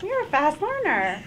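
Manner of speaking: loud laughing compliment; laughing praise. Real, not acted. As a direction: praise her through a laugh.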